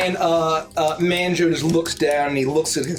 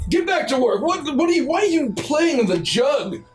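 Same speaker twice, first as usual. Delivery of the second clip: high, fussy scolding; scolding bee boss; 10 semitones higher than usual. Acted. A fussy boss scolds the workers for playing, high voiced. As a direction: nag the slacking workers.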